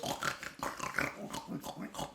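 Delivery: snorting piggy grunt; playful pig snort; pig oink sound. Shown as a demonstration. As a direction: snort like a sow. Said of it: "A sow goes".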